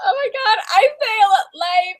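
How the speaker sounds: high, cry-laughing self-mockery; half-laughing, half-wailing at her own mistake. Real, not acted. Embarrassed after a spoiler.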